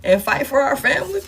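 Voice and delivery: hammed-up retort from the ex; mocking the partner. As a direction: throw the partner's complaints back, mock-accusing. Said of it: "They like".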